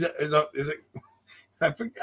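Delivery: ordinary delivery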